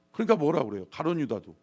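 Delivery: ordinary talk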